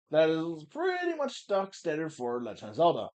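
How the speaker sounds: ordinary delivery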